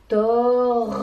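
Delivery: tor tenu longtemps et fort, le r qui roule; tor prolongé. Shown as a demonstration. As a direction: faites couler tor comme un robinet. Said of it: "on entend". (tor étiré et appuyé)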